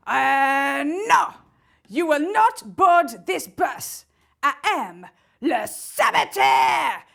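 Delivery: loud, slow mock French saboteur; booming French-accented villain. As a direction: bellow it in a hammed-up French accent. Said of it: "why he's talking in a French accent".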